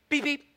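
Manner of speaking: soft high polite little beeps; gentle beep. Pretend. Worried the driver is from church, timid mock beep. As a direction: tap the horn politely. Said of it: "So you just kinda go".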